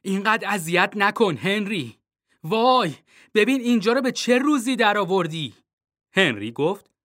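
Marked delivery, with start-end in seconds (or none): 0.0-5.5 high, loud scolding cry; mother yelling at her son; mom shouting. A narrator's reading. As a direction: shriek at the mess. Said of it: "فریاد زد".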